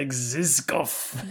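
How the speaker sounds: slow, menacing villain drawl on "Zizkov"; Bond villain voice. Pretend. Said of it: "You gotta say it like a Bond villain".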